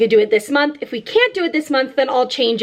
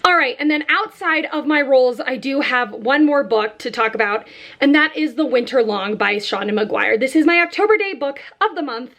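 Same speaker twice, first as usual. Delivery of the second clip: ordinary talk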